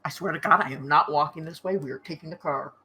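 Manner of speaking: low, quiet pout; grumbling pout, trailing down in volume. Pretend. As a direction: sulk it softly and stubbornly. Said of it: "God, the pout".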